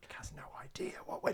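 whispered aside. Real, not acted. Co-host whispering behind Nick's back. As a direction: keep it from Nick.

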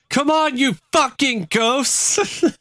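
high, slow mock yell at spirits; yelling at ghosts. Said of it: "Doris began swearing and yelling".

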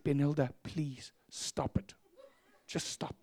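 whispered, slow retold pleading; very soft, drawn-out reproach; hushed plea. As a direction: beg her under your breath to stop. Quietly begging a congregant to stop.

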